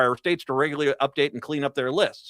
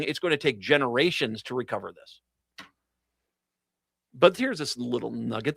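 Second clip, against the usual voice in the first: normal talking voice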